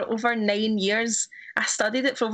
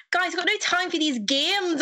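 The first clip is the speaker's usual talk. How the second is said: put-on failed English accent sliding into Irish, pitched up; accidental Irish accent; 7 semitones higher than usual. Retelling a flubbed audition line, accent slipping into Irish. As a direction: attempt English, let the last word slip Irish. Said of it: "went pure Irish at the end".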